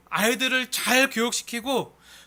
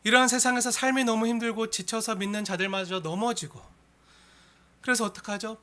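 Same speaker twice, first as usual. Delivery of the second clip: usual speaking voice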